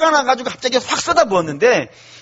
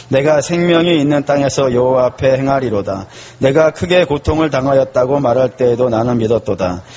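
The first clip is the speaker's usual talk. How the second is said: measured, lower reading voice for the psalm; scripture reading; 7 semitones lower than usual. Acted. Preacher reading Psalm 116 to the congregation, solemn reading.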